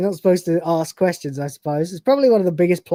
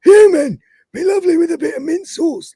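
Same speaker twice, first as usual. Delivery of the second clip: higher, put-on Biden impression; 10 semitones higher, 5 dB louder than usual. Simulant reworked as Biden, higher put-on voice. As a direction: do it like Biden, high and hushed. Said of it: "It's more like Biden".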